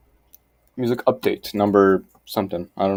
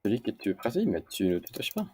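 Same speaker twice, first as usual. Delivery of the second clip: ordinary talk, nothing marked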